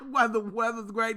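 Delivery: laughing through the words; amused laughter. Real, not acted. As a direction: chuckle while saying it. Laughing at her own joke.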